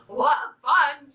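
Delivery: merry mother hen, only lightly voiced; merry hen's cry. A narrator's reading. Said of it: "she cried".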